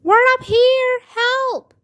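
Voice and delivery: very high, loud yell; calling for help. A narrator's reading. Girls in attic yelling to babysitter, calling for help. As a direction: holler down to a rescuer.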